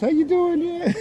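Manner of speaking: high sing-song pet voice; talking to a cute puppy. Real, not acted. Greeting dogs in costumes.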